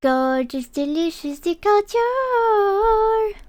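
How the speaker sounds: rising to a squeaky high pitch; high-pitched cute voice. Pretend. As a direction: stretch the last words high and slow. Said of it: "make it more high-pitched".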